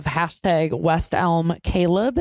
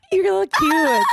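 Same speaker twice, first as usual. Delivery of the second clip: gushing; 11 semitones higher than usual. Real, not acted. Gushing over friend's new fling.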